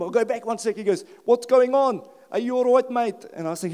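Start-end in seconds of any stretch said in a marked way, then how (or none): 1.3-3.3 put-on raised, loud South African accent; South African cop. South African cop checks on the man in the street, calling out. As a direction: call out as a South African officer. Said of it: "He's South African".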